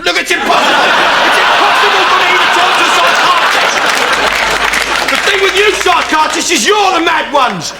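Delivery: put-on shrill, high shouted rant as the girl; hysterical girl. The girl screaming at her psychiatrist, high frantic shout. Girl's outburst quoted in his story.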